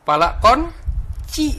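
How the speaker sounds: slow punchline. Acted. Punchline of palkon joke.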